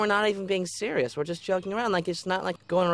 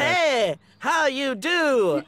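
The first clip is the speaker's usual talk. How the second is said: high, slow mimicry of a kid; Junior; 8 semitones higher, 3 dB louder, slower than usual. Making fun of a classmate behind his back, exaggerated impression. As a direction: stretch the words in a mocking kid voice. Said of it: "[name], do more Junior".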